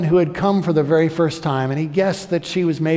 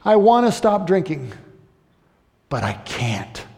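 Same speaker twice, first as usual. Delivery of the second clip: mock sobbing plea; 4 semitones higher, 3 dB louder than usual. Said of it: "she's just sho-, sobbing".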